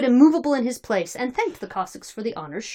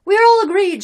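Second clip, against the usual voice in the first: high, loud assent; throng's shout; 12 semitones higher, 10 dB louder than usual. A narrator's reading. Throng approving the new leader, shouted. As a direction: shout agreement as a whole crowd. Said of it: "shouted the throng".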